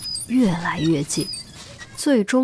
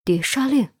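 shocked; 6 semitones higher than usual. A narrator's reading. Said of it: "斯提娜脸色骤然变得煞白".

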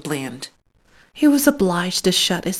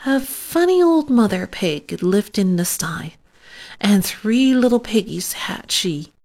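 ordinary delivery

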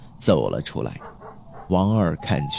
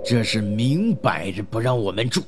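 indignant gang boss, digging in; angry voice; 3 semitones higher than usual. Acted. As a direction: insist angrily on staying. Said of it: "老大怒声说道".

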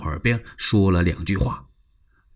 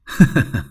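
short laugh; 3 semitones higher, 4 dB louder than usual. Acted. Liu Bei laughs at the question.

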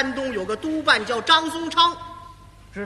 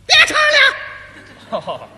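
put-on high, loud, drawn-out yell of an old lady; slow shrieking old lady shout from the audience; 14 semitones higher, 10 dB louder, much slower than usual. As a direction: bellow it at the stage like an annoyed old woman. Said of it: "冲台上就嚷嚷啊".

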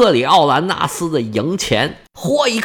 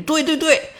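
eager agreement; 12 semitones higher than usual. Acted. Second guard chimes in.

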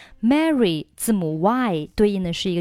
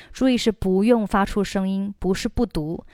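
ordinary talk

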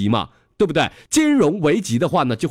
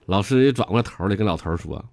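normal talking voice

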